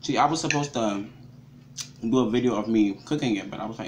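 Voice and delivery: chewing while talking; speaking through food; mouth full of food. Real, not acted. Seen 0: fork goes to mouth at 0.8, then hand covers chewing mouth.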